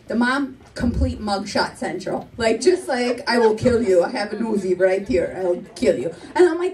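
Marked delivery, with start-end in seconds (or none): 3.3-6.1 low, deadpan Italian-mother threat; menacing mom. Retold or imitated. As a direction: play it stone-cold and low, mock menace. Voices stern mugshot-faced mother's threat.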